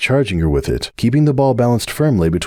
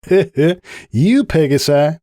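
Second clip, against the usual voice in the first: slow, raised mocking jab; teasing; 8 semitones higher, much slower than usual. A narrator's reading. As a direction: draw it out with a laugh. Applejack teasing rival.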